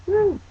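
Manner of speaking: high, loud mock whoop; a sudden high "woo" like the wild guy; imitating a wild whoop; crazy whoop. Mimicking the crazy man.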